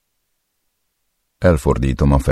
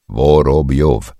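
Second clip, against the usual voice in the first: Vorobjov spelled out syllable by syllable, slow and louder; syllabified; 3 semitones higher, 6 dB louder, much slower than usual. Acted. Torturer to captive, slowly syllabifying the name. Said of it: "szótagolom".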